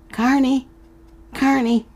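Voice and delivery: high distant calling of her name. Acted. The man calling Carney across the carnival. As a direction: call her from far away. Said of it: "she still heard him calling".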